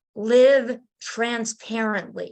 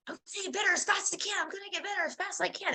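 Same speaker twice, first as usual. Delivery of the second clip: excited retold shout; 8 semitones higher, 8 dB quieter, much faster than usual. Flying with Jesus, gushing her promise fast and high. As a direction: rattle it off high and fast like a joyful vow. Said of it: "and I was shouting".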